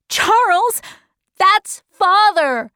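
high, urgent cry. A narrator's reading. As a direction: cry it out in protest, high-pitched. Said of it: "cried Meg".

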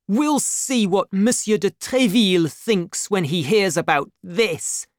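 high, loud indignant threat; defiant cry. A narrator's reading. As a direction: cry out a warning in outrage. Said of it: "cried D'Artagnan".

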